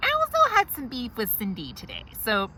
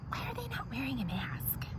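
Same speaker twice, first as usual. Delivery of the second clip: whispered tattling; hushed complaint about the couple; 4 semitones higher, 13 dB quieter than usual. Acted. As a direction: whisper it behind your hand. Complaining secretly at the desk.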